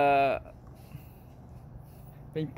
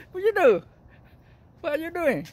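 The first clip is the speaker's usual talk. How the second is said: squeaky praising a dog; 9 semitones higher, faster than usual. Real, not acted. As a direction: coo at the dog in a tiny high voice. Talking to a pet.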